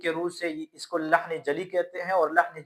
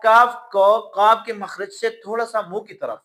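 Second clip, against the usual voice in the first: ordinary delivery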